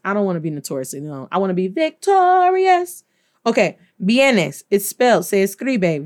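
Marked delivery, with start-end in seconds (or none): 1.7-3.0 higher, proud declaration; triumphant. Shown as a demonstration. Teacher jokes about being victorious, higher. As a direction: sing it out like a winner. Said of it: "I'm saying that's the Notorious".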